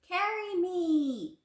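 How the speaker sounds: high, loud, slow demand. A narrator's reading. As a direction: order them to carry you. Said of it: "he demanded".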